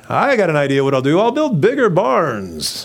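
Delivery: loud, rising self-pleased scheming; hammed-up eager schemer; mock bright idea. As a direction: announce it like a grand plan, stretching the end. Voices man with barn idea.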